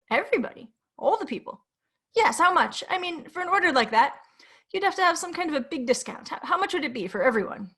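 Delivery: eager and flustered on the phone; nervous man voice. Acted.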